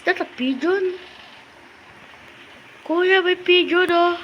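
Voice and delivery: delighted to spot the pigeon; eager call to kuya; excited. Real, not acted. Spots a pigeon in game.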